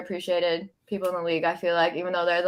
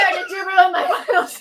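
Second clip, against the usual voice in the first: laughing; 9 semitones higher, 4 dB louder, faster than usual. Real, not acted. Laughing accusation at cohost.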